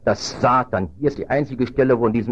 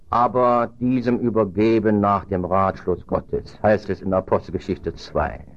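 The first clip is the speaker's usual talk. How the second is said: ordinary talk, nothing marked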